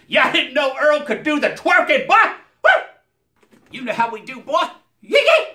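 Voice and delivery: high, shouted hype character voice; Earl voice. Acted. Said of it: "Earl is not easy on the voice box".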